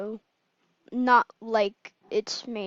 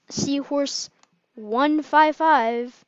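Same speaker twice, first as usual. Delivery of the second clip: "Seahorse one five five" spelled out slowly, each number stressed; slow emphatic repeat; 3 semitones higher, 5 dB louder, much slower than usual. Real, not acted.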